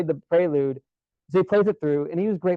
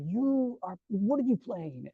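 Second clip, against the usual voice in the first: higher, softer maestro with a Spanish-name accent impression; soft, high-pitched accented maestro; 8 semitones higher, 7 dB quieter, slower than usual. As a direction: question a student in an imitated maestro's accent. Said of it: "I'll do my best [name] accent".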